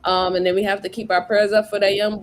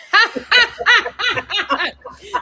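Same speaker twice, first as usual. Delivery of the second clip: high cackling laugh; 10 semitones higher, 5 dB louder than usual. Real, not acted. Streamer cracks up at a viewer's small tip, high loud cackle.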